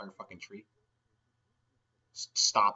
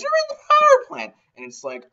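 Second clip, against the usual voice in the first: ordinary talk, nothing marked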